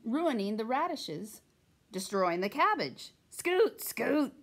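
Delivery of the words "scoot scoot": shooing. A narrator's reading. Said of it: "she said to Thomas and Joseph".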